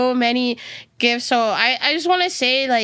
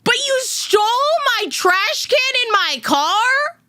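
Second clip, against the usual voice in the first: much higher, hammed-up indignant rant at the thief; mock outrage; 9 semitones higher than usual. Streamer retelling the car break-in to chat, hammed-up outrage. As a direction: play up mock fury over a stolen trash can, much higher. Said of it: "the thing I was crashing out about".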